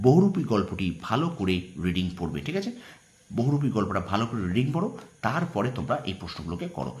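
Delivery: ordinary talk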